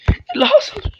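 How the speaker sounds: shocked squeal with a laugh; laughing shriek. Real, not acted. (صرخة مع ضحك)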